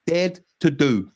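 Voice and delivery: slow and deliberate on "dared to do"; slow emphatic. Real, not acted. Fan quoting the Spurs badge motto slowly. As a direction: spell out "dared to do" word by word. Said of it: "quoting club motto slowly".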